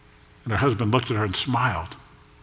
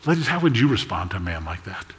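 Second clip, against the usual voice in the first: ordinary delivery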